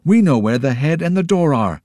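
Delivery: loud and high, bursting with the discovery; excited shout. A narrator's reading. Joe shouting to the group, thrilled. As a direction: shout it out in triumph. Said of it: "shouted Joe".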